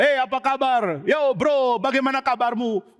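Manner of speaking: put-on high, breezy party-boy greeting; cheerful playboy Amnon. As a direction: greet everyone like a carefree rich kid. Amnon greets loudly, laughing around. (sapaan ceria Amnon si anak pesta)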